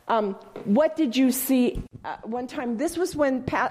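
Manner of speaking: ordinary delivery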